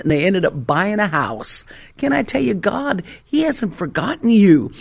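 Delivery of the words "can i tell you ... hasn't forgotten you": higher, building to a loud finish; impassioned preaching. Real, not acted. Preacher assures congregation after testimony, impassioned. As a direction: preach it with rising fervour.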